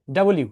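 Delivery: "ডাবল ইউ" said as two parts, double then U. Shown as a demonstration. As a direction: say "ডাবল ইউ" clearly, not "ডাব্লু". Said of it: "এটার জন্ম হয়েছে দুইটা ইউ থেকে". ("ডাবল ইউ" দুই ভাগে স্পষ্ট করে বলা)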